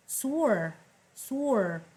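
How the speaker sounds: "Sure" said slowly and clearly as /sur/ with a falling tune; careful teacher model of "Sure"; model pronunciation. Shown as a demonstration. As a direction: say "Sure" crisply, like a vocabulary drill. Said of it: "pay attention to how I pronounce every word".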